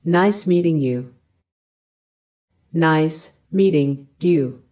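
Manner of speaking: normal talking voice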